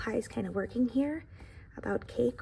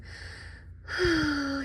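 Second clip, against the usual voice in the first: a long, drawn-out weary sigh; acted sigh, higher pitched; 4 semitones higher than usual. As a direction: let out a big tired sigh for the pie. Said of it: "He's sighing".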